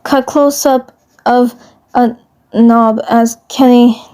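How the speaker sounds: plain reading voice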